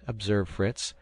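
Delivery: plain reading voice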